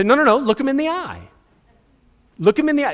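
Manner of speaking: high-pitched, insistent coach's correction. Acted. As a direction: correct the player, pressing the order. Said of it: "coach would make us".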